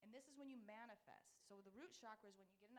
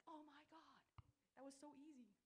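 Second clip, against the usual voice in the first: higher, mildly gushing client voice; excited client; 6 semitones higher than usual. Retold or imitated. Quoting a client's email with mild excitement. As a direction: lightly act out a thrilled email. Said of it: "I get an email like".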